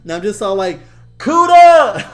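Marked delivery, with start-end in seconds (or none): 1.2-2.1 high, loud call after someone; yelling. Retold or imitated. Calling back his fleeing cousin, yelling. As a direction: holler the nickname far down the road. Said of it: "just yelling at him".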